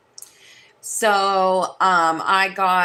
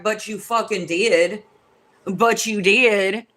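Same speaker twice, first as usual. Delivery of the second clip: slow taunt; slower than usual. Retold or imitated. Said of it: "And I was like".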